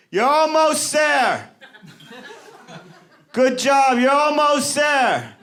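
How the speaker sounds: high, drawn-out cheering from the sidelines; cheering crowd. Retold or imitated. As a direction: call it out like a roadside fan urging runners on. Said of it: "they start going".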